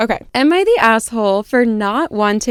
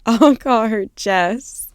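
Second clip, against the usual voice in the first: laughing; slower than usual. Real, not acted. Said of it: "I'm gonna pull it together now".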